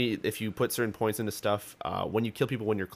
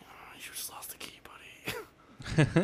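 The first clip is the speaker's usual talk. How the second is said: soft teasing whisper; 7 dB quieter, much slower than usual. Retold or imitated. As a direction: breathe it out gloatingly. Quoting his own teasing reply.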